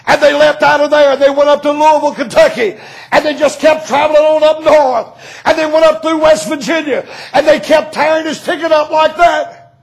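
high, loud revival preaching; preaching at full force. Acted. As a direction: preach it out with fire.

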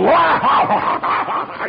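big, high hammed-up horse laugh; mock guffaw. Carpenters laughing at a little girl, mock guffaw. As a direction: roar with imitated raucous laughter.

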